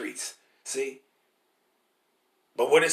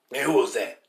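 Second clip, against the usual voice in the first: ordinary talk, nothing marked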